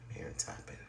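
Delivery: real whisper, barely voiced; hushed whisper. Real, not acted. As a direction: keep it to a breath, unsettled. Scared narrator whispers during activity.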